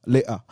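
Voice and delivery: "later" said lay-uh, t swallowed; British glottal stop. Retold or imitated. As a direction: leave the t out of "later". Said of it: "he talks like that".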